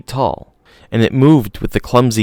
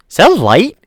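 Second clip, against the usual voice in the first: higher, slightly louder woman's question; a hint of Ellie's voice; 12 semitones higher, 6 dB louder than usual. A narrator's reading. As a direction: ask it a bit higher, curious. Ellie asks, voiced character.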